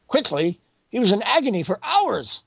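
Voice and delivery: sharp high retort; blunt, no bedside manner. Retold or imitated. Doctor bluntly correcting the grieving mother, indignant. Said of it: "tossed all bedside manner out".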